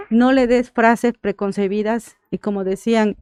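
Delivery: ordinary delivery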